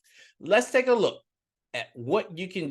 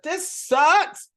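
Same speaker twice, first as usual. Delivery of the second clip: higher, louder whiny complaint; fed-up complaint; 13 semitones higher, 5 dB louder than usual. Acted. Politician stuck in the heat complains, high whiny gripe. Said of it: "he's like".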